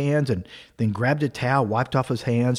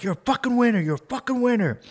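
retold yelling; 8 semitones higher than usual. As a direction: imitate a man hyping himself up, pitched high. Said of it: "he's yelling and slapping himself".

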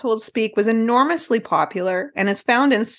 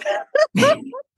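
high, real burst of laughter; cracking up. Real, not acted. Co-host picturing the whole poem, cracking up.

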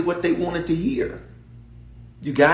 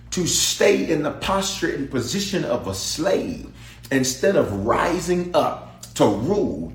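ordinary talk

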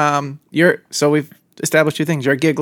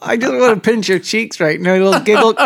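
put-on high cooing baby talk; squealing baby talk aunt; 4 semitones higher than usual. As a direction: coo at him like a cheek-pinching aunt.

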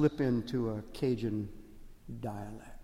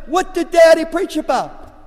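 quizzing dad; 13 semitones higher, 13 dB louder than usual. Retold or imitated. Father quizzing kids.

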